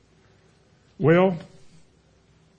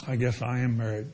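glum admission; 6 semitones lower, 4 dB quieter than usual. Acted. Husband admits sadly.

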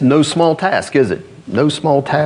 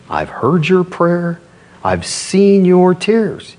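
slow, raised, ringing declaration; emphatic repeat; 5 semitones higher, slower than usual. Acted. Preacher repeats God's answer to the congregation, emphatic and raised. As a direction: drive it home slowly on a higher pitch.

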